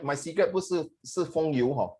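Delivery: usual speaking voice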